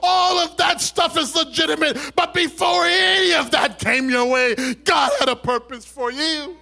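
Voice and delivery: high, shouted sermon delivery; shouted revival preaching, pitched high; full-force preaching. Real, not acted. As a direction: preach it loud to the whole congregation.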